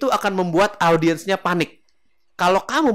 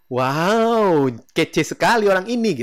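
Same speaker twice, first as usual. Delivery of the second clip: loud, stretched wow of admiration; hammed-up smitten amazement; 3 semitones higher, 5 dB louder, slower than usual. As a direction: gush as if suddenly head over heels. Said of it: "tiba-tiba naksir berat".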